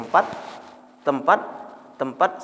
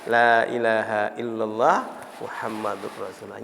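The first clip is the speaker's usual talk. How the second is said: halting recitation; 6 semitones lower than usual. Retold or imitated. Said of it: "sampai dua puluh kali baru bisa ucapkan". (menirukan mualaf mengeja syahadat perlahan)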